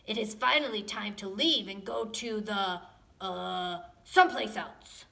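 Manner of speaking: a touch of a big booming dad voice; booming Papa Dinosaur. Acted. Papa Dinosaur pretending they are leaving. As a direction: play the huge dad, hamming it up. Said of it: "in his loud, booming voice".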